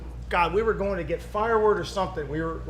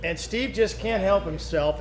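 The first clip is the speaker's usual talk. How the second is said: ordinary delivery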